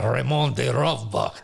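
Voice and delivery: playful fake French accent introducing Raymond. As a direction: roll out the name with a hammed-up French accent. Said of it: "I talk with the French accent".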